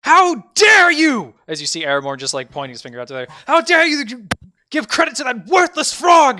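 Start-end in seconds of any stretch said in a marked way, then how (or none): 0.0-1.3 very high, loud, drawn-out scream; outraged accusing shout. Acted. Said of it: "point out and just with his finger go like". 3.5-6.4 high, full-force indignant yell; outraged accusing shout. Acted. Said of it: "point out and just with his finger go like".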